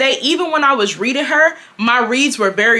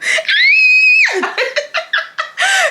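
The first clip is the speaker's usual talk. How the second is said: long, high, loud cackle; loud shrieking laugh; 3 dB louder than usual. Real, not acted. Cracking up to viewers about the fight, loud laugh.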